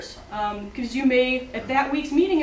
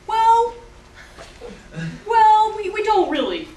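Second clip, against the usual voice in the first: hesitant student; 8 semitones higher, 5 dB louder, slower than usual. Retold or imitated. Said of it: "student leaders come to me and say".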